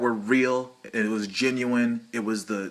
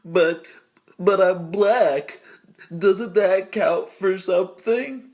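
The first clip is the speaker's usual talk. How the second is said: higher, louder, slow whiny pleading; whiny mock plea; 7 semitones higher, 5 dB louder, slower than usual. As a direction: beg like a spoiled actor, hammed-up. Mocking whiny actor plea.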